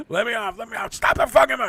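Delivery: panicking on a packed bus, yelling to be let off; panicked yell. Retold or imitated. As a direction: beg to get off, rushing the words. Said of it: "I just yelled out".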